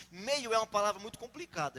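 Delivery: ordinary delivery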